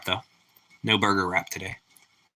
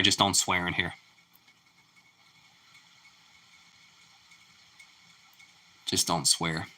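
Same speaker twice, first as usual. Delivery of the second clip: soft muttered warning; 8 semitones lower, 9 dB quieter than usual. Real, not acted. Repeated scolding softly.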